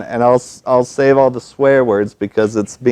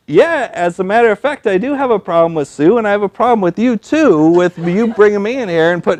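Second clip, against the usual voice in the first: retold outburst; 10 semitones higher than usual. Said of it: "And I said".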